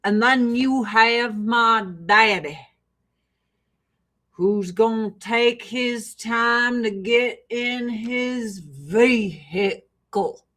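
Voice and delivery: slow, stretched Arkansas drawl; deep South accent. Retold or imitated. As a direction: imitate dad's unhurried deep Southern drawl. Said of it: "very slow and very deep South".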